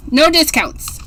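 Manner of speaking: usual speaking voice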